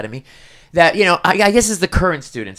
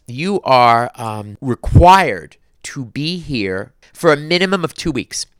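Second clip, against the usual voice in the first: slow, weighty announcement; official notice; slower than usual. Retold or imitated. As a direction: spell out the requirement word by heavy word. Reads out jury duty notice.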